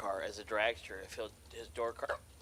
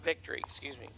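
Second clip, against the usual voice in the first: announcing through hiccups. Real, not acted. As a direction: talk while hiccuping. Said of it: "I got the hiccups now".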